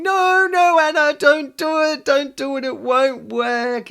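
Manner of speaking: high, loud pleading inner voice; a worried inner voice crying no. Retold or imitated. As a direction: beg yourself not to buy it. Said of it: "which part of me was going".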